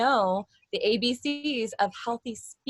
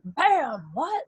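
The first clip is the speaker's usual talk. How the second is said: sister's TV character; 4 semitones higher than usual. Retold or imitated. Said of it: "the character would be like".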